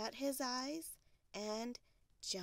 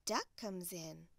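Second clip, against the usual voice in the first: plain reading voice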